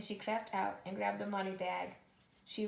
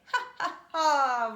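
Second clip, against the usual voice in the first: loud booming laugh, much higher; roaring giant laugh; 5 semitones higher, 13 dB louder, slower than usual. A narrator's reading. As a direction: roar the laugh out in triumph. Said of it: "roared the giant".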